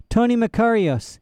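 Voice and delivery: put-on high, lost little boy; a dazed small boy asking. A narrator's reading. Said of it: "he said".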